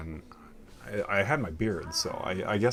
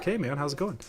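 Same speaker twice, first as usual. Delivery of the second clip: higher, upbeat cheery hello; friendly normal greeting; 10 semitones higher than usual. Retold or imitated. Installer greeting a suspicious customer, extra-friendly. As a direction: sound as normal and friendly as possible. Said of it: "completely normally".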